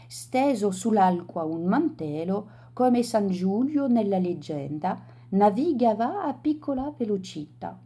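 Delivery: ordinary delivery, nothing marked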